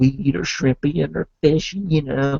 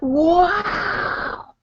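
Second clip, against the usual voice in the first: high wow shouted, then a slower higher marvel; can't believe there's no wait; amazed exclaim; 9 semitones higher, slower than usual. Retold or imitated. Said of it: "And I'm like".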